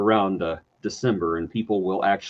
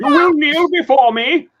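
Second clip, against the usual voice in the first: booming high villain command; 8 dB louder than usual. Retold or imitated. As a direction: order them to kneel, grandly. Villain line quoted from show.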